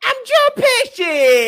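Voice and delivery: put-on very high, slow, drawn-out Italian-American accent; hammed-up squealed Italian-American accent; Joe Pesci. Host trying a Brooklyn accent for the guest, wildly exaggerated. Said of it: "I'm doing that Joe Pesci accent".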